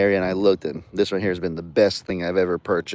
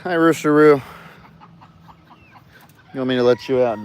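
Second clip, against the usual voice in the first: pet voice; 2 semitones higher than usual. Acted. Owner greeting his dog Roosteroo in pet voice. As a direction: sing the dog's name out fondly.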